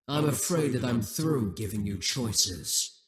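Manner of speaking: usual speaking voice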